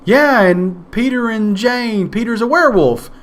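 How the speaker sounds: chirpy hostess. Retold or imitated. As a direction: introduce the guests brightly like a proud host. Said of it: "And they say".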